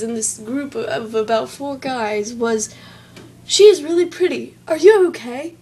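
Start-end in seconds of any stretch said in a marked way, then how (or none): 3.5-5.6 higher, louder mock Australian accent; put-on Australian accent, loud and high. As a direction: quote him as an Australian, pitched up. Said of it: "Made him out to be all Australian".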